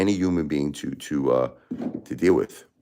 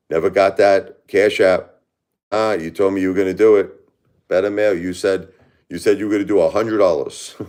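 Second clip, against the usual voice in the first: loud call-out; 5 semitones higher, 6 dB louder than usual. Real, not acted. Calls out friend over unpaid money.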